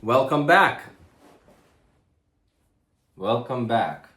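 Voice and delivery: loud, high shouted welcome; shouting. Real, not acted. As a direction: call it out to a far-away camera. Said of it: "why am I shouting?".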